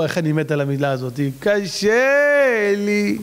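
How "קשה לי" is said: hugely stretched whine; mock complaining. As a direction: imitate a self-pitying moaner. Imitates the complaint he hates.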